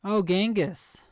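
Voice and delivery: light up as the name clicks; sudden realisation. Real, not acted. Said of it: "Gotcha, Genghis".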